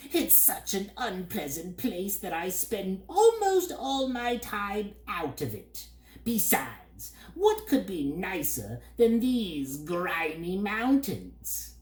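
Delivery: high bird voice, slow at the end; disdainful bird. A narrator's reading. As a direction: sneer at home like a grubby bird. Bird figure.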